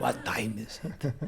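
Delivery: slow mocking echo in a heavy Russian accent; Russian-accented coach mimicking the actor; teacher's heavy accent. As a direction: parrot his line back to show how it sounded. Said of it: "obviously had a very heavy accent".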